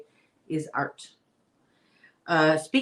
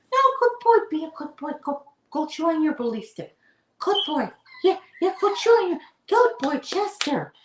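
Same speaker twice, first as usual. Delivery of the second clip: much higher pet-praising voice; puppy talk; 10 semitones higher, 4 dB louder than usual. Real, not acted. To her puppy Chester, much higher dog-praise voice. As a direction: coo up high at a good puppy.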